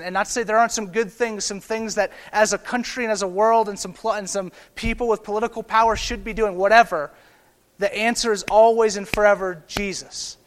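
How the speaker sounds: loud, rising pulpit fervour, pushing each phrase harder; preaching at full force. Acted. Pastor urging his church, full-voiced preaching. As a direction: preach it like the room must hear.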